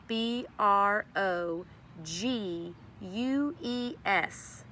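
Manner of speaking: slow, letter by letter; spelling it out. Shown as a demonstration. Teacher spelling the word for her class, slowly letter by letter. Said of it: "It's spelled".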